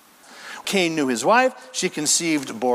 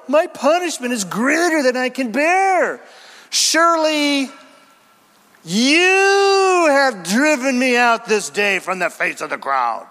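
high, loud complaining plea; a whining Cain crying out; whiny Cain; 6 semitones higher, 4 dB louder than usual. Acted. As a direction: wail it like a self-pitying man. Preacher voicing Cain's lament.

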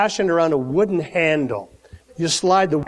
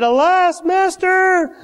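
high, raised wail of dismay; dismayed cry; 10 semitones higher, 3 dB louder, slower than usual. Acted. Servant wailing to Elisha over the lost axe, high and hammed-up. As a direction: plead with the master in a hammed-up panic. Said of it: "And he said".

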